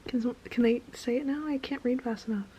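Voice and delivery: stunned, hushed disbelief; incredulous whisper. Real, not acted. Reader reacting to plot twist: disbelieving whisper. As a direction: whisper it like you can't believe it.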